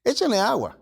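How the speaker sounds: "Échenle agua" más agudo, desafiante; jactancia. Retold or imitated. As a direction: ordena echar agua con aire de reto. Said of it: "se está jactando aquí". ("Échenle agua" dicho con jactancia, más agudo)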